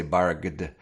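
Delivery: "barged" as barg-d with a heavy D; voiced G-D ending on "barged"; wrong voiced ending. Shown as a demonstration. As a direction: mispronounce barked as "barged", hard G and D. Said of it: "Please don't say barged".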